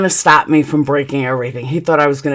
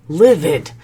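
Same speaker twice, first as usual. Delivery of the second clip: ordinary delivery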